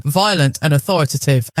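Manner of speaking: ordinary delivery, nothing marked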